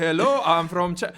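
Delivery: briefly attempted mock Chinese accent. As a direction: put on a hammed-up Chinese accent. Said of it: "do this very racist accent".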